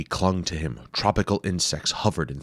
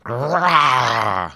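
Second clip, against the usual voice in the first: lizard roar; 7 semitones higher, 8 dB louder than usual. Acted. Lizard lunges roaring.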